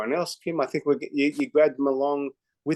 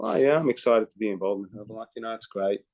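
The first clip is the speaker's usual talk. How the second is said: lower, lukewarm mock answer; unexcited; 5 semitones lower, faster than usual. Mocking a distributor's bored answer, lower and half-hearted. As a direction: sound like you barely care. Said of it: "No, no, no, no".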